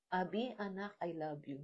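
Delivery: sweet and loving. Retold or imitated. God reassuring his child Abi after her wrongdoing, tender and quiet. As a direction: let it fall soft as a caress. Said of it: "In a very sweet and loving way".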